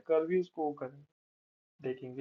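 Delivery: normal talking voice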